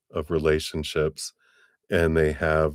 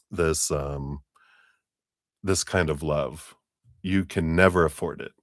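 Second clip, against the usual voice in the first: ordinary talk, nothing marked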